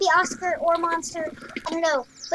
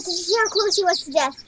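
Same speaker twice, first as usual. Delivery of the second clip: ordinary talk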